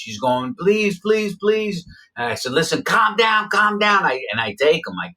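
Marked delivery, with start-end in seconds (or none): 0.6-1.8 much higher, pleading please, please, please; frightened broken-English plea. Retold or imitated. The carjacked man begs him not to rob him, high panicked pleading. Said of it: "He don't speak good English". 2.5-4.0 urgent calming. Retold or imitated. Calming a panicked hostage.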